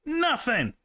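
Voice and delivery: high exclaiming Nothing. Real, not acted. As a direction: cry out Nothing, pitch jumping up. Calls again, louder, no answer.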